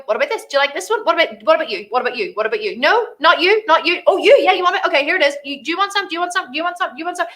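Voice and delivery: playfully offering to each person; playful seller offering around. Retold or imitated. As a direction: hawk it to everyone around.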